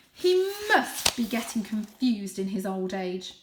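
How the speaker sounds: loud, dismissive father cat; blustering father. Acted. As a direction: brush the old cat aside. Father dismisses elder loudly.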